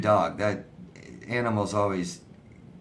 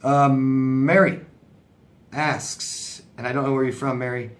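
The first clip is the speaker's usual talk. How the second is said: usual speaking voice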